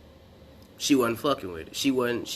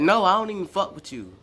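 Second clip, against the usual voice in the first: higher, irritated retold rebuff; getting mad; 7 semitones higher than usual. Classmate brushing him off after he asks if she's okay, irritated. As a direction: imitate her snapping back, pitched up. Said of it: "was getting mad".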